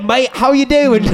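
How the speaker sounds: a cheeky London bloke saying hello, in Cockney; hammed-up Cockney greeting; Cockney British accent. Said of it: "I want him to sound like".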